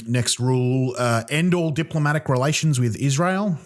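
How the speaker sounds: ordinary delivery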